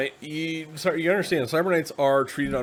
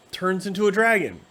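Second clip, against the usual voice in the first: ordinary talk, nothing marked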